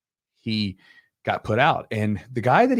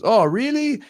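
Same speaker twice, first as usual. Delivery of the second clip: high, louder imitation of astonishment; mock surprise; 13 semitones higher, 6 dB louder, slower than usual. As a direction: react as if just told astonishing news. Choked student learns what happened.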